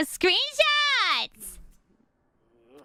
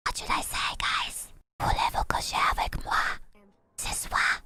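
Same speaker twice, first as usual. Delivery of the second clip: unvoiced whisper right in your ear; seductive whisper; 6 dB quieter than usual. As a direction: breathe the words softly into the ear. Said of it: "Whisper seductively".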